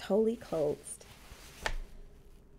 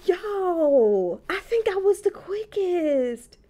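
loud, high, drawn-out exclamation; astonished dismay; 10 semitones higher, 7 dB louder, slower than usual. Real, not acted. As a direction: wail it loud and high in disbelief. Amazed at losing so quickly.

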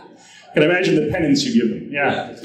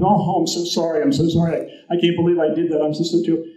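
a touch higher, mildly flustered sorry; lightly voiced apology; 6 semitones higher, faster than usual. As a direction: stammer the apology again, mild distress. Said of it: "And he goes".

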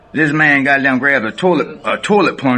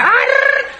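very high, short mock yelp; imitated movie scream. Retelling the movie, a very high mock yelp. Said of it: "that motherfucker said".

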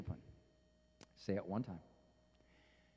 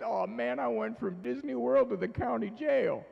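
higher, louder mock dismay of a little boy; boy's dismay; 7 semitones higher, 5 dB louder than usual. Dad voices his son's shock at bedtime, hammed-up dismay. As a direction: play the child's shocked inner voice. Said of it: "the look in his face".

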